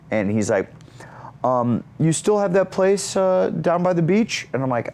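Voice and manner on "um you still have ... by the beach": high, tentative imitated request; Zac Efron. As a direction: feel out a favor from a friend, pitched up. Zac angles for an invitation.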